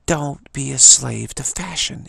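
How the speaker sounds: put-on adamant New York accent, slow and loud. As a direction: draw it out firmly like a New Yorker. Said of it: "in her nice New York accent".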